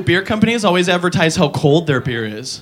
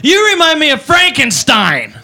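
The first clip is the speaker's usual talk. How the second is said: slow, exaggerated drunk insult; drunk girl; 5 semitones higher than usual. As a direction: slur it like a proud drunk girl. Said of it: "She goes".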